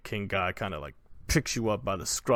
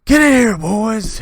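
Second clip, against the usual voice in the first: much higher, louder gloating brute; a bandit chief breaking into a house; bandit king; 11 semitones higher, 13 dB louder than usual. Acted. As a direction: bellow to his men. Bandit king calls his men.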